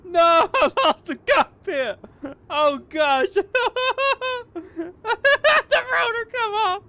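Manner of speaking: high-pitched shrieking laughter; laughing helplessly at the crash; hysterical laughter. Real, not acted. Ejected from crashing craft, laughing.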